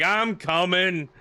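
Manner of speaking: put-on reluctant complaint, words stretched; unenthusiastic angry guy. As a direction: imitate a cranky man with a cane. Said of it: "he didn't seem too enthusiastic".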